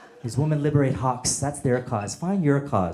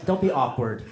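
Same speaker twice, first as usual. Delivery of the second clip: normal talking voice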